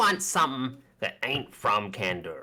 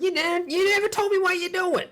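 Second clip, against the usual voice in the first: high, indignant NPC voice; the shopkeeper feels left out; 5 dB louder than usual. Acted. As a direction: whine it high and put out. NPC complaining, high pitch.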